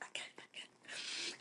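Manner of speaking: imitating mom's barely audible whisper; whispered aside. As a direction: whisper it away from the phone. Mom overheard muttering aside.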